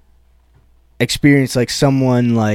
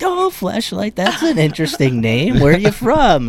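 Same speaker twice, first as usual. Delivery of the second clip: sweetly curious grandparents; 5 semitones higher than usual. Retold or imitated. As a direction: imitate innocent grandparents, higher and lilting. Voicing his polite grandparents in story.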